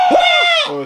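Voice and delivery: ordinary talk, nothing marked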